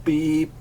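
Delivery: high drawn-out beep; time signal beep. Shown as a demonstration. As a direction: imitate a radio time pip. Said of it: "how does the noise go?".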